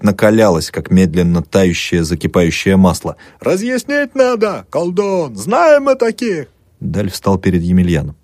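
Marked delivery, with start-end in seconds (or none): 3.4-6.5 high, slow jeering from afar; distant crowd. A narrator's reading. Crowd outside accusing the doctor, distant slow jeer. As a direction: call it from outside the window. Crowd shouts through window.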